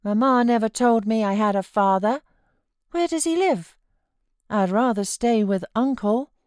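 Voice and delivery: put-on a fretful child pleading to stay; sickly child. A narrator's reading. Boy refusing to leave his uncle, high fretful whine. Child Linton voiced by narrator.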